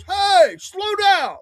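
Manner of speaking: high, loud shout from the roadside; retold neighbor yelling at a speeding driver to slow down; mock yell. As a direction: call out to the speeding driver, half-hamming it. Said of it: "And the guy goes".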